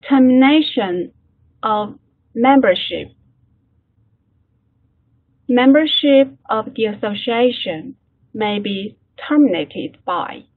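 slow, deliberate English read-aloud for learners; model reading. Shown as a demonstration. Teacher models the text for class, slow careful reading.

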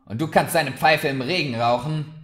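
sharp barmaid scolding a guest; angry retort. A narrator's reading. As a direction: bite the words off at him. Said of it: "fauchte Murdra".

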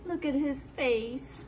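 ordinary delivery, nothing marked

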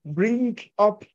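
ordinary delivery

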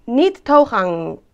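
slow, loud "Niet toegang" with throaty g; deliberate Dutch sign reading. Shown as a demonstration. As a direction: read it like a door sign. Reads a sign out.

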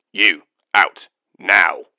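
loud helmet-projected command; echoing voice inside helmet. Acted. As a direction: bark through a helmet speaker. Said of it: "Projected from his helmet, Atten's voice was intimidating".

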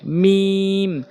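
"মিম" held long and level, closed on the lips; lips-together articulation. Shown as a demonstration. Teacher shows learners the Arabic letter, lips-together long "মিম". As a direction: stretch "মিম", pressing the dry lips together. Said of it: "দুই ঠোঁটের শুকনো অংশ মিলিয়ে".

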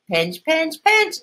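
high, lilting little chant, rising to the end; grandma's sing-song voice. Acted. Grandma teaching Amy to pinch the buns, high and sing-song. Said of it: "says Amy's grandma".

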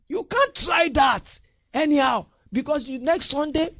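high loud warning; high loud preacher warning; warning shout. Real, not acted. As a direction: snap a warning at them. Warning congregation.

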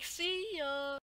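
high, loud mocking farewell; gleeful taunt. Student taunting the fallen teacher as he runs for the bus. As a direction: toss it back while escaping.

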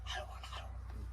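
urgent hush, barely voiced; hushed whisper. Warning the visitor to keep it down, hushed whisper. Hushing the partner during secret meeting.

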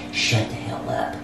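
low, slow, whispered warning; menacing mock warning. Sister tired of her sibling's questions, menacing. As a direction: drop to a slow, hushed growl.

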